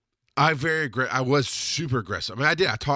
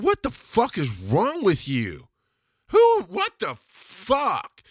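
exasperated anger; 7 semitones higher, much slower than usual. Real, not acted. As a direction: stretch each word in disbelief. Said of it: "held on to that anger".

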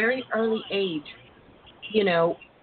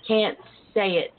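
usual speaking voice

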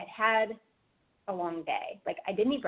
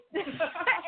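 light laugh; 9 semitones higher than usual. Real, not acted. Laughing after the retort.

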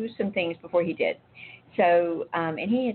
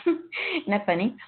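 laughing; 5 semitones higher, slower than usual. Real, not acted. Amused aside after retelling breakup.

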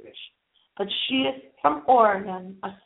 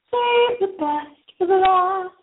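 excited build-up; 8 semitones higher, 4 dB louder, slower than usual. Real, not acted. Announces big news with fanfare.

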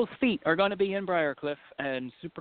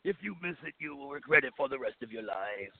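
ominous promo delivery; dramatic ad voice; 3 semitones lower, 7 dB quieter than usual. Shown as a demonstration. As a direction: sell it big and grave. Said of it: "You gotta put it over bigger than that".

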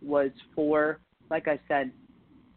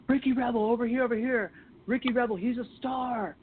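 imitating photographers yelling; 8 semitones higher than usual. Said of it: "photographers, like, screaming at me".

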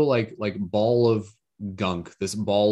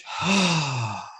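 long audible releasing exhale; dramatic sigh; 7 semitones higher than usual. Shown as a demonstration. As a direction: sigh it all out. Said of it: "bit of a sigh".

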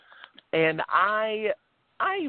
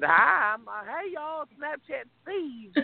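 Snapchat Steve; 10 semitones higher, slower than usual. Retold or imitated. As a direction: introduce a comic alter ego in a bad Southern accent. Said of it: "attempt at a Southern accent".

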